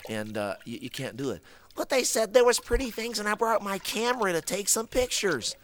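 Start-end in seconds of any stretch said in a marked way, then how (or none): 1.8-5.6 high pleading puppet voice. Acted. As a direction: whine like a goofy puppet.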